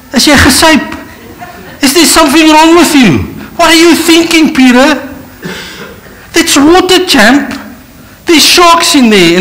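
high, loud mock disbelief; Peter's friends mocking his idea; incredulous mock. Mocking what most would say.

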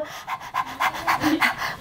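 quick voiceless pants like a dog; dog panting. Retold or imitated. Dog sitting at the man's feet with money, panting.

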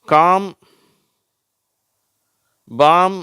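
காம், பாம் loud and high with no L sound; silent L. Shown as a demonstration. Teacher showing the L-M spelling, காம், பாம் with silent L. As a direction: say காம், பாம் as long vowel straight into M. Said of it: "ரெண்டு வார்த்தையில எல் வந்து silent ஆயிடுது".

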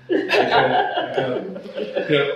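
can't stop laughing, words breaking; real laughter swallowing the words. Real, not acted. Breaks up laughing mid-story.

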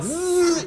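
high buzzing shock noise; electric buzz. Retold or imitated. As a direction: mimic a zap.

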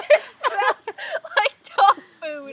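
slow, giggling, near tears with laughter; slow, helpless laughing repeat; laughing through it. Real, not acted. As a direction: laugh so hard the words stretch out. Repeats line while cracking up.

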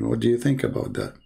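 ordinary talk

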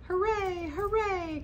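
cheering. A narrator's reading. Clown and toys cheering at the top, high cheer. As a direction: cheer with joy. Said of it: "cried the funny little clown".

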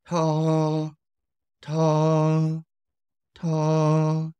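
high level first-tone "Ta". Shown as a demonstration. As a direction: keep "Ta" level at the top of the voice. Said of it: "the same utterance".

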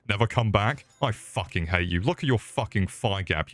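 usual speaking voice

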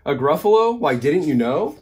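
storybook mouse showing off what it knows; knowing surprise. A narrator's reading. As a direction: exclaim it high, amazed they haven't heard. Mouse answers the puzzled owl.

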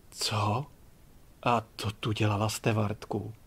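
low, hushed disbelief; stunned. A narrator's reading. Passenger learns the stewardess is the owner's daughter, stunned. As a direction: drop the voice, softly stunned. Stunned by who she really is.

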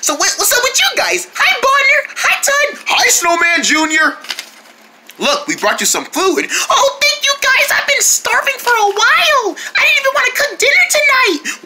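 very high, squeaky cute voice. Acted. Snowman Junior thanking friends for food, in a high cute voice. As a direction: keep it tiny and sweet. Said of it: "that's the voice".

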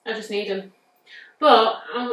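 ordinary talk, nothing marked

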